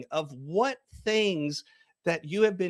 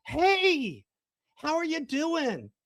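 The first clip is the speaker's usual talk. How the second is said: higher, bright call across a room; calling out to a friend; 9 semitones higher, much slower than usual. Acted. Hailing an old friend across a restaurant, excited call.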